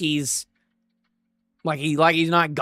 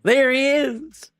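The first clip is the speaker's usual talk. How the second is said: higher and higher, louder with each line; excited spotting; 9 semitones higher, 3 dB louder than usual. Real, not acted. Spotting Garling in the crowd, climbing excited squeals. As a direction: point him out, getting more and more hyped.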